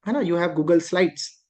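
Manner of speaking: ordinary delivery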